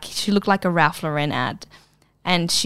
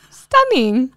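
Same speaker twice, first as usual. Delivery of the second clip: mock dreamy sigh; 6 semitones higher, 5 dB louder than usual. Looking out over Sydney street.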